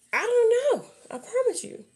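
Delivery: 1 high protest. Real, not acted. Defensive reply to people's question.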